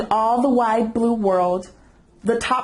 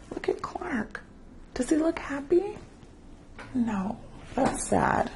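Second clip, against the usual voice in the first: hushed aside; 4 semitones higher, 6 dB quieter than usual. Real, not acted. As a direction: lean in and whisper to the little ones. Reader asks children aside.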